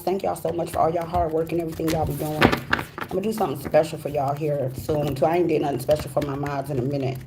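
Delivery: ordinary talk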